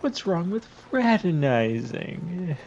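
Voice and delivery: high, slow whiny drawl. Retold or imitated. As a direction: whine it like a petulant young knight. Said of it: "his little, like, whiny voice".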